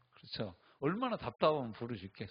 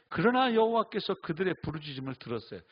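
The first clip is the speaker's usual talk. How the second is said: scripture reading; 4 semitones higher, 7 dB louder than usual. Acted. Scripture read.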